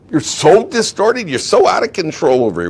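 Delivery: high, loud exasperated rebuke; exasperated scolding. Acted. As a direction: scold him in mock disbelief. Acted scolding addressed to Korach.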